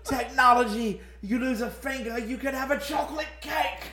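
very high, loud, hammed-up British; bad British pitchman crying out in wonder; horrible British accent. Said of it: "I was talking in this horrible British accent".

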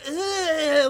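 loud, long, high "aah" with tongue out; tongue-out yell. Retold or imitated. Imitating the comic's pussy-eating act, loud tongue-out yell. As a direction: mimic a lewd tongue-waggling holler. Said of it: "with his tongue out".

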